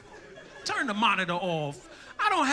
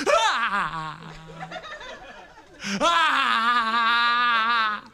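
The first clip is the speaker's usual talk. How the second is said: loud hammed-up crying wail; mock wail; 5 dB louder than usual. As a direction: wail like a bawling kid. Kid enters class wailing.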